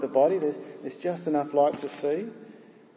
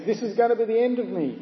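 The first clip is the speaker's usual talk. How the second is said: high, mildly panicked inner voice; a touch of dread, high-pitched; alarmed thought; 7 semitones higher than usual. Acted. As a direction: voice a driver's alarm, pitched well up. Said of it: "our man thinks".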